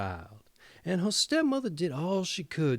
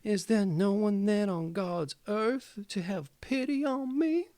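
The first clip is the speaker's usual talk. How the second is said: crying plea; 6 semitones higher than usual. A narrator's reading. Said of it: "laid her face ... and cried".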